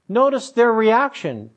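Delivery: usual speaking voice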